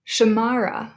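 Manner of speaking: "Shamara" as sha-MAR-a; stress on second syllable. Shown as a demonstration. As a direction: lean on the middle of "Shamara". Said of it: "we emphasize the second one".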